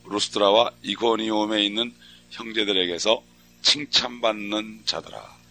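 slow, deliberate Bible reading; scripture reading. Acted. Preacher reading Acts aloud to the congregation. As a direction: read the verse slowly, with weight.

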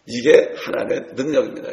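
normal talking voice

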